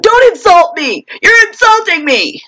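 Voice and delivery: mock offended outburst; offended man. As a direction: imitate a man taking offence, hammed-up. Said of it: "sent him into a whirlwind of".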